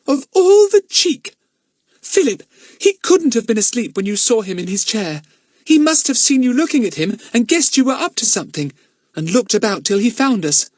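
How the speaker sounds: put-on high, loud girl scandalised at the cheek; a girl fuming about being spied on; indignant girl. A narrator's reading. Dinah fuming to Philip about the snooping man, high and loud.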